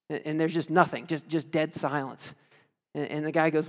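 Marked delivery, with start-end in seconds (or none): none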